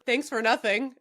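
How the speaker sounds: ordinary delivery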